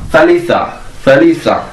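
thalitha sounded out in Arabic, th as in think; Arabic pronunciation. Shown as a demonstration. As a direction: say thalitha as a teacher demo. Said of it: "plus f plus ta marbuta". (wymowa arabska, thalitha z angielskim th)

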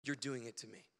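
quiet solemn words; hushed. Retold or imitated. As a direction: let it fall to near silence. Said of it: "And she said".

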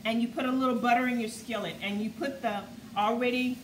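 ordinary delivery

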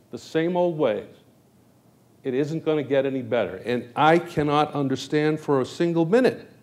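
rally the room; rousing campaign speech. Acted.

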